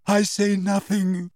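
slow, high, choked-up martyrdom; tearful wounded dignity. A narrator's reading. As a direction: hold back tears theatrically. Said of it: "she choked".

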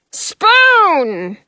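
loud royal bellow. A narrator's reading. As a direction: bellow it like a king. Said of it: "the king bellows".

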